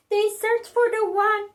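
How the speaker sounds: ordinary delivery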